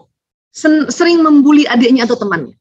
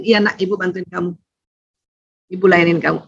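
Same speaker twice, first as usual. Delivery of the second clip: tender mother; 5 semitones lower, 3 dB quieter than usual. Retold or imitated. Mother soothing a shouting child.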